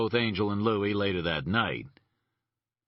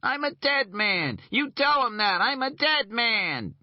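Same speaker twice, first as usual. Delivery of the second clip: whining voice. Acted. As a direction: whine it out in panic. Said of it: "I could hear Regal's whining voice".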